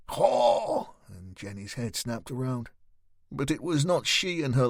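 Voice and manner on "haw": high, loud mocking hoot; jeering yell. A narrator's reading. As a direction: yell one derisive syllable, pitched way up. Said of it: "A woman's jeering voice yelled".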